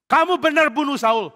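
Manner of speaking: loud, high challenge; David presses the liar hard; demanding question. Acted. Daud demands the truth. (Daud membentak dengan keras dan tinggi)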